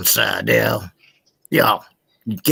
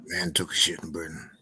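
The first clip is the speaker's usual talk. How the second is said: normal talking voice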